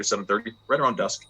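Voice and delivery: ordinary delivery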